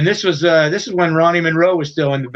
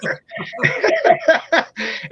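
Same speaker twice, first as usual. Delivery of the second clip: high laugh. Real, not acted. Laughing after the quote.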